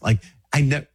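normal talking voice

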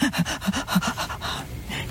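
long, wheezy gasp for air; mock breathless gasp. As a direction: gasp like a winded climber. Mimics a tired climber.

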